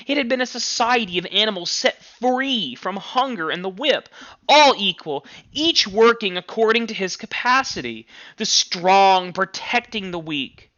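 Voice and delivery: a lifted, grieving voice over the lost dream; tearful reading. Acted. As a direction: read it up high as if close to tears. Clover's tears, lost hopes.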